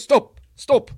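big booming shout of a Brazilian grappler; bellowing coach. Retold or imitated. Heavyweight yelling at his small partner to stop, booming. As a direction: bark it out like a huge training partner. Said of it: "deep, bellowing voice".

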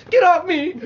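sudden high, very loud yell; mock outraged shout. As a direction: shout it as the startled inmate. Retold inmate lashes out.

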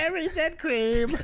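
high, slow squeaky meme voice; meme squeal. Pretend. Meme catchphrase echoed high.

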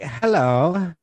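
high mock voice of a chick at the bar; put-on joking-sounding voice. Woman approaching a man at a bar, high affected imitation. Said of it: "joking by the way she was talking".

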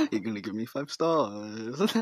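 a mild creepy insistence; creepy driver voice. Retold or imitated. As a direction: driver coaxing for a rating, lightly acted. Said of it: "I'll voice over it for you".

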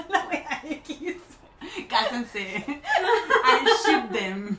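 loud laughing I ship them; gushing fan, laughing it out. Real, not acted. Friends joking about a shipped duo, laughing loudly.

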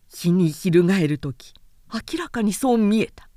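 usual speaking voice

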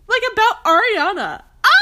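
high, loud shriek then a laugh; outraged shriek. Real, not acted.

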